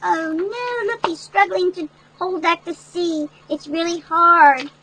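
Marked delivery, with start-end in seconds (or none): none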